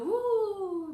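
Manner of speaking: un «¡uh!» agudo y fuerte, como en la cima de la montaña rusa; grito de subida alegre. Retold or imitated. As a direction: suelta un «¡uh!» de emoción hacia arriba. (grito agudo de montaña rusa)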